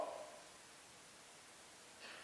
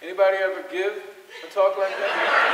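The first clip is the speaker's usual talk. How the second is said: louder, laughing question; 4 semitones higher, 10 dB louder than usual. Real, not acted. Speaker calls out to the lecture audience, loud and laughing. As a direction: call it out to the room with a laugh. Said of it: "repeats the question to the audience".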